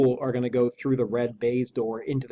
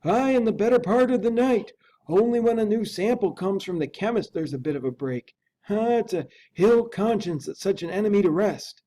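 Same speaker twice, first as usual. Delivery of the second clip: higher, louder fretful servant voice; a frightened old butler unsure of what he saw; Poole the butler; 9 semitones higher, 3 dB louder than usual. Acted. Butler tells the lawyer about the masked figure, high and fretful. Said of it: "whispered Poole".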